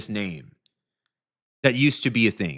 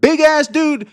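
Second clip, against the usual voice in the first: incredulous rant; 14 semitones higher, 7 dB louder than usual. Real, not acted. Exasperated ranting about crying streamer.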